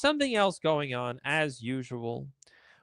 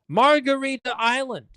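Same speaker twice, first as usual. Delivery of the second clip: higher, gleeful sarcastic pounce; mock excitement; 8 semitones higher, 3 dB louder than usual. Sarcastically cheering the Marines' easy target with mock excitement. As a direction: savour the name like a prize.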